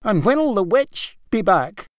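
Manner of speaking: high and anxious; worried boy asking about the witch; fearful question. A narrator's reading. Young Niall fearing the witch's return, high and anxious.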